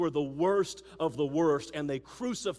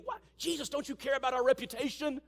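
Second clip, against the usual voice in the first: indignant disciples; 7 semitones higher, 4 dB louder than usual. Retold or imitated. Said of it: "the other disciples have to be thinking".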